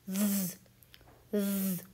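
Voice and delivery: ज़ drawn out slowly as a buzzing voiced th; voiced th demo. Shown as a demonstration. Teacher demonstrating the voiced th to students, slow and buzzing. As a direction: hold ज़ long with the tongue between the teeth. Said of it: "ये वो दो तरह की टीएच sound".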